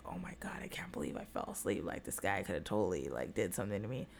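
very low, hushed, near-whispered private thought; inner voice. Retold or imitated. Woman retells her panicked thought on waking, inner voice. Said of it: "in my head, I was like".

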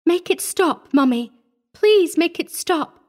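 put-on high, pleading little-girl cry; child's desperate plea. A narrator's reading. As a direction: beg mother urgently for help. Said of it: "the whisper fairly screamed".

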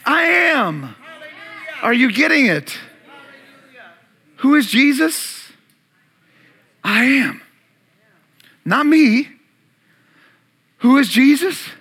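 loud, high calls with long pauses; full-force preaching. Acted. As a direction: throw each short line out to the crowd.

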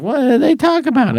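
high mock question. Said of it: "I can't be like".

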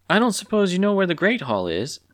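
high-pitched, timid question; meek, hopeful asking. A narrator's reading. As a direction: ask it high and tentatively. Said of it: "asked Clucky timidly".

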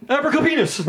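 high magic-word flourish; magician. Pretend. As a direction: cast it like a magic trick. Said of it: "I feel like I'm a magician".

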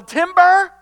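high, loud, ringing shout; big urgent holler; God's warning yell. Acted. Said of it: "Would you rather God yell".

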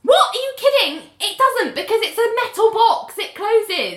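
high, disbelieving outcry; incredulous. Real, not acted. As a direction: react in total disbelief. Incredulous at phone claim.

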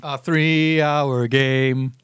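low, slow repeat; mock deep echo. Echoing the co-host's words in a slow low voice. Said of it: "echo of co-host".

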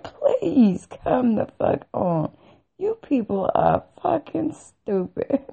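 high, loud mocking retold rant; incredulous scorn. Telling off the hospital staff about pants, incredulous scorn.

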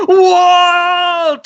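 high, loud anguished yell of a name; mock howl of Walt; imitated scream. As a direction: scream the name like a desperate father. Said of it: "supercut of him just screaming".

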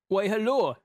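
put-on seductive German accent. Adolf opens the door, seductive bad German accent. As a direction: attempt a seductive German greeting. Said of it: "he says seductively".

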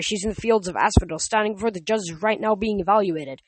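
ordinary delivery, nothing marked

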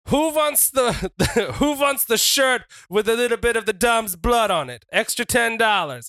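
high, carrying hawker's cry; hawking to crowd. Acted. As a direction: call it out like selling to the stands. Wrestler hawks bloodied shirt to crowd.

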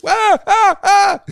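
high, loud, short screams; re-enacted flashback screams; three imitated screams. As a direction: scream three times in quick cuts. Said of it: "the three screams that he makes".

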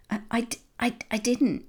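high, halting guilty stammer. A narrator's reading. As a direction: stumble over the denial in alarm. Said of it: "he started".

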